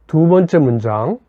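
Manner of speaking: ordinary delivery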